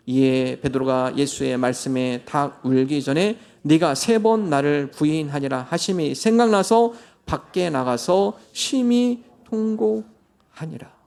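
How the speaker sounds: slow, measured reading of the verse; solemn scripture reading. Acted. Pastor reading the verse to the congregation, slow and solemn. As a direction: read it slowly like holy text. Scripture read aloud slowly.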